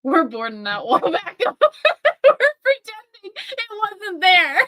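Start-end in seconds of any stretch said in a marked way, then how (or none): 1.6-4.6 laughing through the words, slowed; can barely get it out for laughing. Real, not acted. Laughing while speaking.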